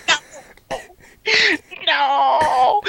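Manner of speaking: high, loud mock wail; anguished rival. Conrad sees the belt taken, anguished wail. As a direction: wail it like a sore loser in despair. Imitates Conrad losing the title.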